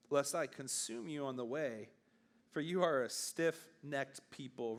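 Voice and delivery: low, measured Bible reading; solemn scripture read to the youth group. Acted. Reads Exodus 33 aloud.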